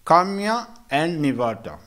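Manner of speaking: usual speaking voice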